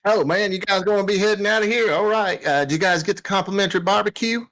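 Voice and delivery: high, easygoing put-on country voice; folksy comm operator. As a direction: chat amiably in a high rustic voice.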